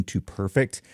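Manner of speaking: normal talking voice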